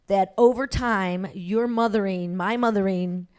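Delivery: ordinary talk, nothing marked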